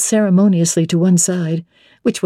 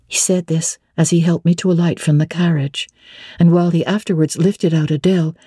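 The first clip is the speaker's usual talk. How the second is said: plain reading voice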